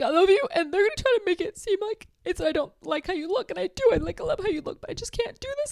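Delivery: imitating tearful pleading. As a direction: gush it out in a high, tearful rush. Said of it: "She's like".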